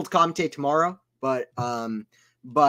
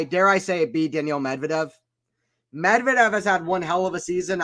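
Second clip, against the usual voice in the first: normal talking voice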